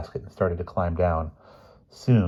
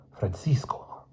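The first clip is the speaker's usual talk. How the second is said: unvoiced, slow whisper of the name; 2 semitones higher, 6 dB quieter, slower than usual. A narrator's reading. The stranded boy answering from the basket, whispered. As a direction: breathe the name out, voiceless. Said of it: "a voice in an accent".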